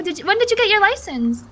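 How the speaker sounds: ordinary talk, nothing marked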